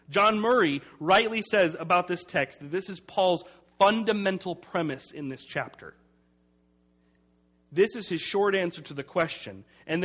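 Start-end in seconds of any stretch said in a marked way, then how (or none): none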